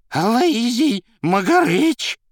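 high, loud, drawn-out wail; terrified answer. A narrator's reading. Man dropped from the sky answering Azazello, frightened high wail. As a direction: squeal the name slowly in fright. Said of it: "ответил тот, дрожа".

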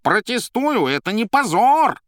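loud, high-pitched protest; yelling cat. A narrator's reading. Cat objecting to the Master, high yell. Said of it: "орал кот".

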